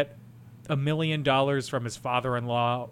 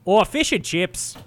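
slow, higher mock-British with Wisconsin vowels; teasing imitation of a Wisconsin accent; British slipping into Wisconsin; 4 semitones higher, 4 dB louder, slower than usual. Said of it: "keeps slipping into a Wisconsin accent".

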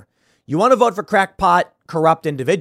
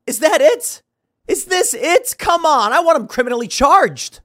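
mock disbelief; 10 semitones higher, 3 dB louder than usual. Real, not acted. Mocking a weak accusation.

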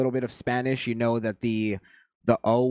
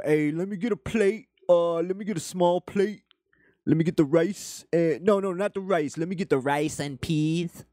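higher-pitched put-on Jamaican voice; mock Jamaican accent; 5 semitones higher than usual. Mocking Jamaicans ordering rice and peas, exaggerated Jamaican accent. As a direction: ham up a Jamaican ordering food. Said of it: "it's like Jamaicans".

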